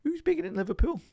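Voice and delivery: high, exaggerated Scouse accent. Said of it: "in the most Scouse accent".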